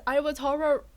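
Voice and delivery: usual speaking voice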